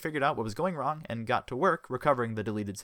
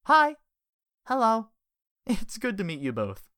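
raised pitch, lightly voiced woman; female character voice; 12 semitones higher, 7 dB louder than usual.

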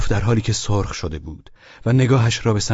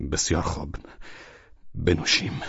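subdued halting reply; faltering, tongue-tied; 5 dB quieter than usual. A narrator's reading. Host gives in to a drink, faltering. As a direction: give in haltingly, with gaps. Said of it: "ولچانینوف با لکنت زبان گفت".

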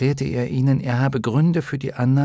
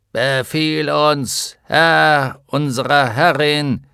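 slow, metallic armored-giant voice; tinny giant voice; 5 semitones higher, 6 dB louder, slower than usual. Acted. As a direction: speak like a hollow iron giant. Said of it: "mit blecherner Stimme".

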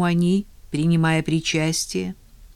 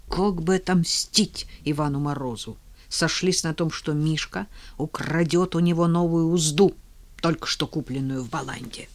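ordinary delivery, nothing marked

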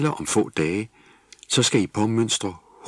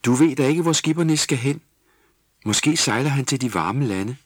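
high, louder protest; defensive; 7 semitones higher, 5 dB louder than usual. Acted. Jule defending the bath toy to Tulle, high protest. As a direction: defend yourself, rising and urgent. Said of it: "Jule forsvarede sig".

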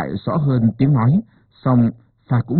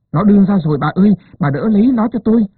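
high, louder and fast; urgent cry; 8 semitones higher, 3 dB louder, faster than usual. A narrator's reading. Wife crying to midwife as baby comes, high rushed cry. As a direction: cry it out in a rush.

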